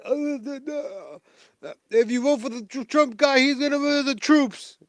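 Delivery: put-on high, loud, rambling old man voice; mocking Joe Biden. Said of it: "That's how he talks".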